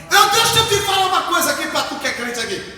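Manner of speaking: loud, high, shouted preaching; preaching at full force. Acted. Pastor urging believers to stop grumbling and pray, shouted sermon. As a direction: rouse the church, calling them to the altar.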